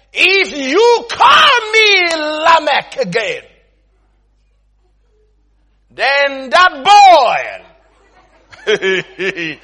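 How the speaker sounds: slow, high, shouted mock threat; threatening oath. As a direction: swear a curse on them, drawn out and hammed up. Friend's angry threatening oath, acted.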